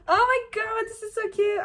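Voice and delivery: real delight; excited gush. Real, not acted. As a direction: squeal over how cute it is. Reacting to the blurb.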